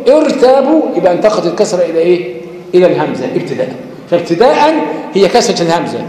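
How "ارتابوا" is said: ارتابوا begun with a kasra on the hamza, rising; ارتابوا said as if opening the recitation; starting on the word. Shown as a demonstration. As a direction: begin ارتابوا fresh with the initial i sound. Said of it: "لو بدأت تقول".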